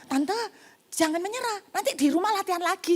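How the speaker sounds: mock pastor comfort. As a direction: coax the auntie like a chirpy pastor. Pastor comforting auntie, mocked. (pendeta membujuk tante, nada tinggi dibuat-buat)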